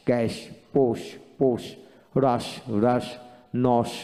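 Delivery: each word said twice with the same even force; steady, careful word-list reading; word drill, each twice. Shown as a demonstration. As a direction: repeat every sh word twice, evenly, like a pronunciation drill. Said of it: "আপনি যদি প্রতিটা শব্দ দুবার করে উচ্চারণ করেন".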